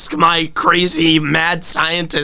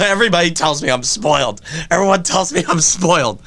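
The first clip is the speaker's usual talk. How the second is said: usual speaking voice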